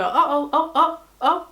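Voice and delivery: mock panic. Her inner voice panicking over getting older.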